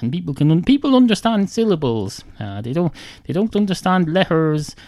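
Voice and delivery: ordinary delivery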